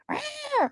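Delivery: mock feisty "rah" growls; imitated cat snarl. Imitating the panther's answer to sweet talk, mock snarl. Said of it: "She's like".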